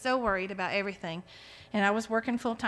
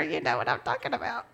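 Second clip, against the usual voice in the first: ordinary talk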